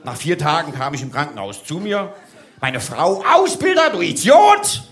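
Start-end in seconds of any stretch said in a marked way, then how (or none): 3.2-4.8 wife's yell. Retold or imitated. Wife yells at him in hospital.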